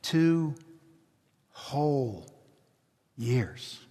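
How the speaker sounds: "Two", "whole", "years" each set apart by a pause; "Two whole years", each word left to hang; word by word emphasis. Shown as a demonstration. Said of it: "different emphasis".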